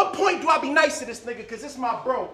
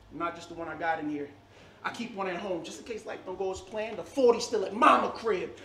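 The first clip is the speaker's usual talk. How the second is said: ordinary delivery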